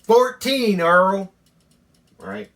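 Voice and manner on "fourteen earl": loud, high call to a friend in another room; hollered answer, much louder and higher; hollering across the room. Retold or imitated. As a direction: shout it out so Earl can hear from afar. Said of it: "So I holler back".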